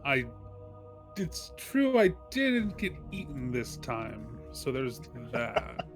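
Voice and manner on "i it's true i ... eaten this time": ordinary talk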